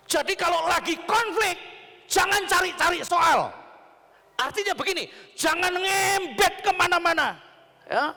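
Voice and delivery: high, loud exhortation; full-force preaching. Real, not acted. Preacher warning couples not to pick fights, forceful preaching. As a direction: cry out the warning to the room.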